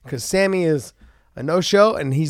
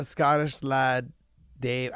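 slow tryout; 5 dB quieter, much slower than usual. Acted. Trying out the name slowly.

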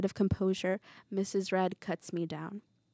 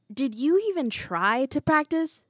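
high, loud accusing question; 6 semitones higher, 6 dB louder than usual. A narrator's reading. Teacher confronting a pupil over unpractised lines, accusing. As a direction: scold with indignant force.

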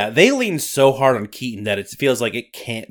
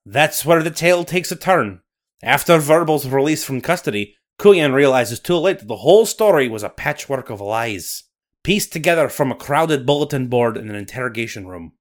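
louder Scottish storyteller voice; Scottish accent; 4 dB louder than usual. Acted. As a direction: narrate like a Highland storyteller. Nessie narration.

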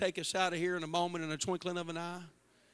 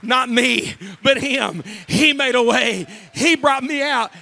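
much louder, ringing preacher's cadence; full-force preaching; 4 semitones higher, 18 dB louder, slower than usual. Acted. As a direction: hammer each "By grace" home to the crowd.

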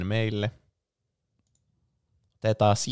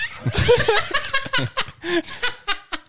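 embarrassed high giggle. Real, not acted. Embarrassed to tell story.

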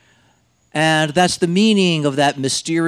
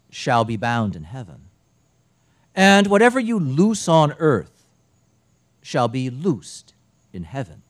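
solemn liturgical reading voice; gospel reading. Acted. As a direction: proclaim the gospel in church.

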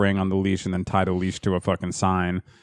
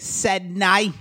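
higher-pitched put-on English accent; comic English-accent voice; 8 semitones higher than usual. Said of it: "I'm giving her an English accent".

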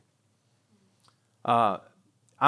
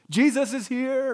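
high, loud, drawn-out sing-out; mock cheery announcement; 11 semitones higher, 5 dB louder, slower than usual. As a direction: announce it with hammed-up, carefree cheer. Mock breezy reassurance Jesus doesn't give.

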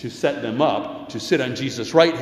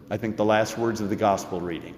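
usual speaking voice

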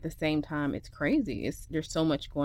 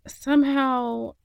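higher, louder and slow on somehow; the dramatic reveal word, pitched up and stretched; stretched disbelief; 7 semitones higher, 7 dB louder, slower than usual. Real, not acted. Reveals the disaster.

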